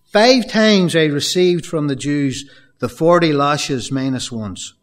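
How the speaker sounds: slow impassioned sermon reading; fervent preaching. Acted. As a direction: press each hardship home, slowly. First reading of Paul's account.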